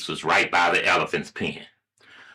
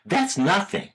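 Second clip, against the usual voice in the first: higher-pitched dismissive mom; awkward motherly evasion; embarrassed mother; 10 semitones higher than usual. Acted. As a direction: brush it off, a little flustered. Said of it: "rather embarrassed".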